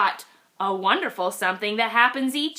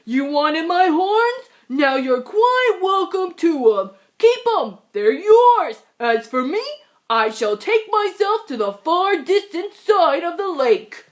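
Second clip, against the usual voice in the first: higher, louder, triumphant farewell; calling out; 5 semitones higher, 4 dB louder than usual. Acted. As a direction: fling it back at the pests. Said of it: "he called to the pests".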